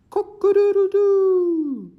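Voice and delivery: long, very high, loud rooster call; rooster crow. Acted. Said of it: "he cupped his hands to his mouth and crowed".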